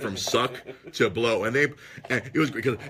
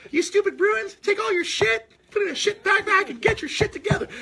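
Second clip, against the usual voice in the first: much higher cartoon-kid voice, a bit louder; Morty Smith impression; 4 dB louder than usual. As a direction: pitch way up and scold like a cartoon teen. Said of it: "To quote Morty Smith".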